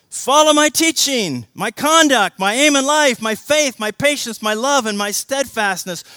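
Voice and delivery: loud and high, each item hammered; preached at full force. Shown as a demonstration. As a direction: drive every my home. Same verse re-read, now preached.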